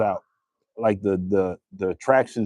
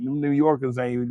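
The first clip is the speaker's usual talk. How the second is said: normal talking voice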